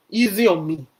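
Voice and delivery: loud pleading. Retold or imitated. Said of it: "she looks up to heaven and say".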